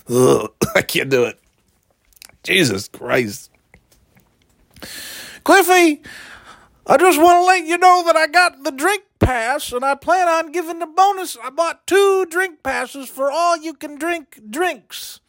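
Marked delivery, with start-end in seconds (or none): 5.4-15.2 put-on much higher, sly party-boy voice; high-voiced schemer. Bragging to Cliffy about drink passes and ladies, very high sly voice. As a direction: crank the pitch way up and brag slyly.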